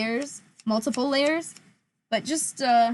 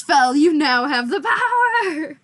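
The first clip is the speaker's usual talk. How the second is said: laughing mock proclamation; 4 semitones higher, 6 dB louder, slower than usual.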